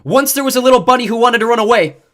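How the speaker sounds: loud, high megaphone yell. A narrator's reading. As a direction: yell through cupped hands. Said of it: "Again I yelled".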